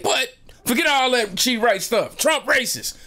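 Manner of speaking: high-pitched, stubborn retold outburst; heated mock fury, pitched high; blinded with rage. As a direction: brush it aside indignantly, voice way up. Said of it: "I'm blinded with rage".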